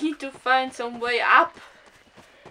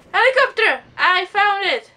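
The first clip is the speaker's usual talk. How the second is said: loud, high cry of discovery; excited shout; 7 semitones higher, 10 dB louder than usual. Real, not acted. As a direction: call it out thrilled. Spots the crash site.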